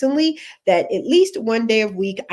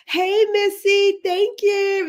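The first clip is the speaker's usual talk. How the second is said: high, stretched hey to a viewer; bright high greeting to a viewer in the chat; excited greeting; 10 semitones higher, 3 dB louder, much slower than usual. Real, not acted. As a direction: sing out a welcome to Missy.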